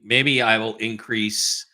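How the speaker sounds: ordinary talk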